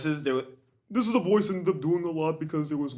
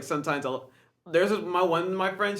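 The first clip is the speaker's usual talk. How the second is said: usual speaking voice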